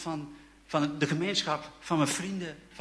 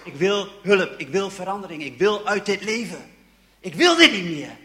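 louder, pleading cries for change; desperate outburst; 3 semitones higher, 10 dB louder than usual. Retold or imitated. Young man pours out his heart to his friend, loud pleading. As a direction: blurt out your need for help.

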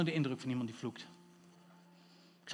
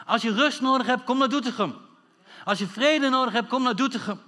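raised, louder preacher's call; emphatic pulpit invitation; rallying preaching; 6 semitones higher, 7 dB louder than usual. Acted. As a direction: preach it out to the whole crowd.

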